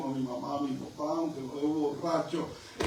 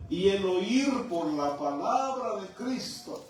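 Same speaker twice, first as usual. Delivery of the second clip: ordinary talk, nothing marked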